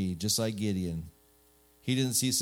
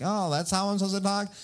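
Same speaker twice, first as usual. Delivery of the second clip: put-on high, whining child voice; hammed-up childish pestering; whiny kid; 8 semitones higher than usual. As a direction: nag like a small kid learning to whine. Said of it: "someone that whines more".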